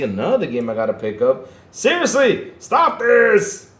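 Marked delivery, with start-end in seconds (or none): 1.7-3.7 high, loud protest; exasperated shout. Real, not acted. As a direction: cry out, fed up with temptation. Mock protest at too many games.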